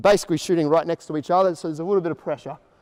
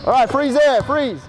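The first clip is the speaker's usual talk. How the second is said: loud, high freeze call; calling stop; 7 semitones higher, 4 dB louder than usual. Real, not acted. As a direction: halt the whole group at once. Stopping the drill.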